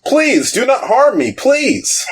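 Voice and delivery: very high, wailing plea; pleading king. Acted. Evil king begs the adventurers for mercy, pleading. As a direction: beg in a high, cowering voice. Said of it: "comes back out and goes".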